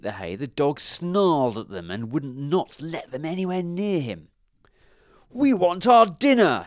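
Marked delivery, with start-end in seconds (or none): 5.3-6.6 high, loud demand; demanding cow. A narrator's reading. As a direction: cow protesting for its food. Cows demand their hay.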